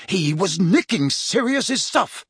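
high, indignant outburst, drawn out; bursting out. A narrator's reading. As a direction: burst out with the complaint. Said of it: "Harry burst out".

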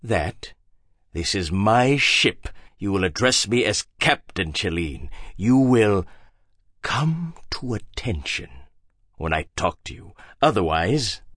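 low, quiet, slow menace; stern captain. A narrator's reading. Hagbard conditioning Harry, low slow stern captain. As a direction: hold him still with quiet threat. Hagbard voiced lower and softer.

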